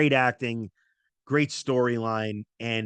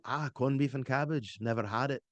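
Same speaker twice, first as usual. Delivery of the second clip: put-on Irish accent; 6 dB quieter than usual. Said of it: "I could just do Scottish".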